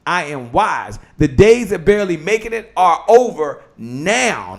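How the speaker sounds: punched, deliberate affirmation with drawn-out key words; declaration. Real, not acted. Host reading a declaration for the audience, emphatic. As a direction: proclaim each line firmly, phrase by phrase.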